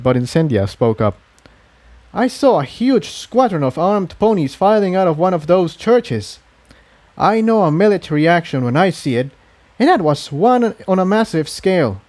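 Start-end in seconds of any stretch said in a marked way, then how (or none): 2.1-12.0 put-on higher, serious young mare reporting. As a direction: report what you saw, urgently. Incendia reports the soldiers.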